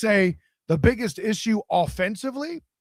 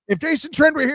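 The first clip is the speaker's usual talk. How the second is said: usual speaking voice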